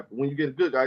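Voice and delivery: ordinary delivery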